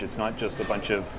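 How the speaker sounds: normal talking voice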